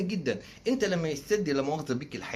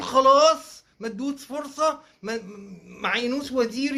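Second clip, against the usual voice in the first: higher, loud mock mother pleading; mock mother's voice; 7 semitones higher, 5 dB louder, slower than usual. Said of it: "تقول". (صوت أم متوسلة بنبرة عالية وبتمثيل ساخر)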